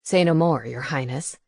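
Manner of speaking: low, dutiful owl; low owl voice pledging help; owl familiar voice. A narrator's reading. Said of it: "he replied".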